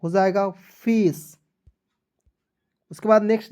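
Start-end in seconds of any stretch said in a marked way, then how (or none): 0.0-1.4 फिश drawn out at the end; teacher modelling a word; slow model reading. Shown as a demonstration. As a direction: say it slowly for pupils. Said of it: "तब दोस्तों ए के रूप में करेंगे।".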